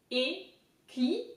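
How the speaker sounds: "E, cli" as separate French syllables; French word broken into pieces. Shown as a demonstration. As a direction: sound out "cli" as "klee" after a lone "E". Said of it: "So it makes".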